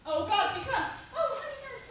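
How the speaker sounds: loud eager cry; excited. Acted. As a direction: burst out with eager joy. Juliet sees nurse arrive.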